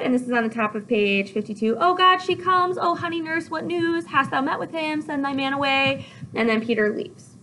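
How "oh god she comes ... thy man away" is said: high-pitched, eager rush of questions; excited Juliet. Acted. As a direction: act out an over-excited girl. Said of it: "Juliet's all excited".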